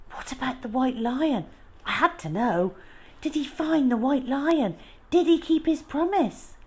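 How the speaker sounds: put-on high, pleading child's questions; eager child. Child begging the old lady for the ending, eager and high. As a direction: beg to know the ending.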